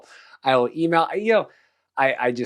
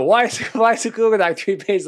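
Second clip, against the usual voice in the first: higher, louder mock incredulous question, laughing; mock exasperation; 9 semitones higher, 4 dB louder than usual. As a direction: play the baffled co-host, half laughing. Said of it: "you go".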